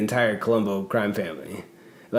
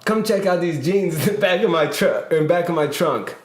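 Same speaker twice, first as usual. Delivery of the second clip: higher, pushy New York wise-guy pitch; New York accent; 7 semitones higher than usual. Retold or imitated. [name] quotes the client pointing to his trunk in a New York accent. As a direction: insist on showing the jeans in a New York accent. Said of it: "thick New York accent".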